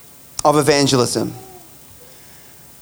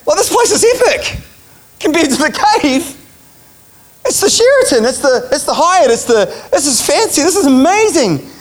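much higher, thrilled and amazed; awed excitement; 9 semitones higher than usual. Retold or imitated. Preacher marvelling at Jesus' house versus the cave, higher and thrilled. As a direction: gush like you've walked into a palace.

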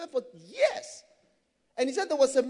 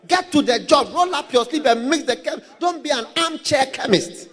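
loud, punchy quoted lecturer; punched-out orders, mock lecturer; lecturer's exhortation; 3 semitones higher, 6 dB louder than usual. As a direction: urge them loudly, imitating a fired-up lecturer. Quoting the lecturer's exhortation to work.

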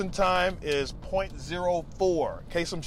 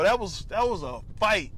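ordinary talk

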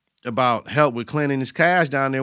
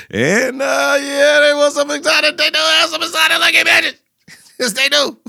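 high, loud and giddy; giddy excitement; 14 semitones higher, 6 dB louder than usual. Real, not acted. Vlogger hyped about the strip club dancers, high giddy laughter. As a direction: squeal it, half laughing with delight.

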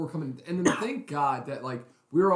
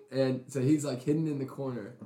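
usual speaking voice

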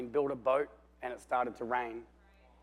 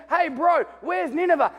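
calling out; 12 semitones higher, 7 dB louder than usual. Acted. Stranger calls out to Jonah.

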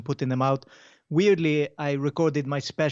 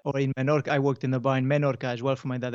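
ordinary delivery

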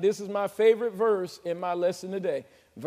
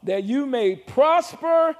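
slow, stressed "prosper"; emphatic reading; 4 semitones higher, 6 dB louder, slower than usual. Shown as a demonstration. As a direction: land hard on "prosper". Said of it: "whatever you want".

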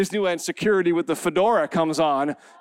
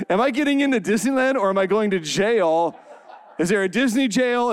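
ordinary delivery

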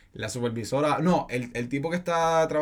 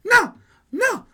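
high, loud mock shriek of no; imitated shriek; 7 dB louder, much slower than usual. Young woman screaming at the clerk, high mock shriek. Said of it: "porque ella estaba gritando".

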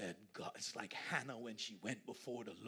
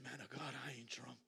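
hushed plea; 7 dB quieter than usual. Retold or imitated. Hannah answering the man of God, whispered plea. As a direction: plead softly, almost breathless. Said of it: "She said".

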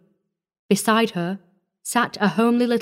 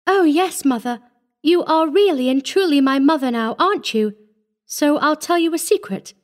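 put-on higher-pitched, eager child voice; happy little girl; 7 semitones higher than usual. A narrator's reading. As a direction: voice a little girl sharing a secret. Child confides happily in new mother.

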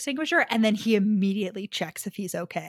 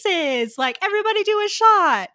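higher and louder, a hammed-up cheery party call; mock party-starter Piper; 11 semitones higher, 5 dB louder than usual. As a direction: play the oblivious life of the party. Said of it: "Piper shows up there with, like, shots and is like".

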